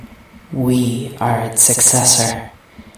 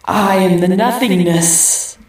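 mock howl; 9 semitones higher, slower than usual. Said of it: "she howled".

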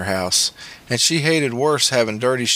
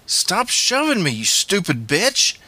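high, slow angry yell; screaming; 7 semitones higher, slower than usual. A narrator's reading. Boy screamed at stepmother shoving him, screaming. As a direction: yell it out high, stretching each word. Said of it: "I screamed at her".

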